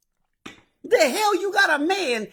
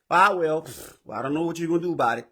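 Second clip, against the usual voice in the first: lower, softer, fast careless shrug of a voice; lazy indifference; 9 semitones lower, 5 dB quieter than usual. Retold or imitated. Mocking a lazy husband whose wife's truck broke: indifferent shrug. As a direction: toss it off like you couldn't care less.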